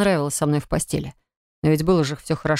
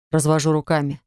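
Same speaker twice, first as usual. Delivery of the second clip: ordinary delivery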